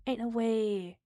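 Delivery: ordinary delivery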